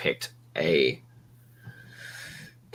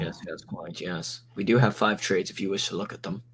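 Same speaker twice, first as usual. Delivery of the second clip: low, soft, proper English gentleman; Sherlock Holmes voice; 3 semitones lower, 5 dB quieter than usual. Acted. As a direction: play the polite English butler, hushed. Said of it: "What's with the Sherlock Holmes shit?".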